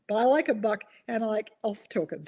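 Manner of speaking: ordinary talk, nothing marked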